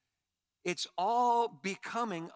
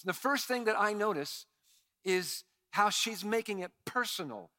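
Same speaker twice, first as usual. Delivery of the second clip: usual speaking voice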